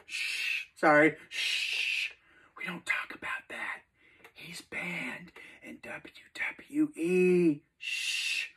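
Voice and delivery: hushed, secretive whisper. Pretend. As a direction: whisper it like a forbidden name. Hushing about a banned wrestler.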